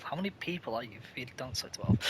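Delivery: very soft, fast whispered quip; whispered don't say twelve; hushed mock question. Real, not acted. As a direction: mutter it in disbelief. Mocking the order.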